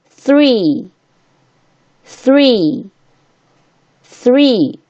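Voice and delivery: three with a bitten-tongue TH and long high-falling EE; tongue-between-teeth TH. Shown as a demonstration. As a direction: say three slowly, tongue on the TH, vowel held. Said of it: "这个T H呢".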